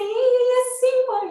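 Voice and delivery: high, slow drawn-out imitation; retold distress. Son answers mother during crisis, high drawn-out retold distress. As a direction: stretch the words out on a high pitch.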